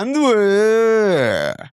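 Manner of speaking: high, sung "and where" falling; over-the-top Korean. Shown as a demonstration. Tutor showing over-the-top practice intonation, high. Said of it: "go over the top".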